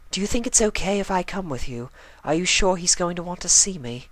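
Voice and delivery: high, refined pony lady voice, worried; Rarity voice. Acted. Rarity asks Twilight climbing the tower, worried high voice. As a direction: ask with anxious high elegance. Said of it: "Rarity asked".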